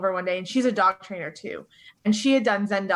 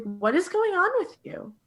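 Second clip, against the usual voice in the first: concerned friend; 9 semitones higher than usual. Retold or imitated. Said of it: "she's like".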